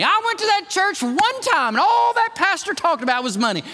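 hammed-up whiny churchgoer griping about money; mock grumbling visitor. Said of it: "please don't leave going".

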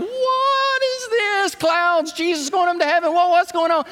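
high, frantic mock panic; panicked disciples. Disciples panicking as Jesus rises, high mock panic. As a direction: squeal it like a startled follower.

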